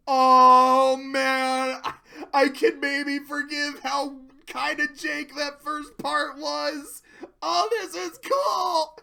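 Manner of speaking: loud, high delighted; excited. Real, not acted. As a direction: gush with excitement.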